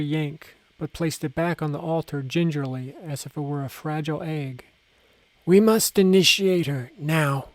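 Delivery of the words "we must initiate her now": shout. A narrator's reading. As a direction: proclaim it loud to the elders. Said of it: "she shouted".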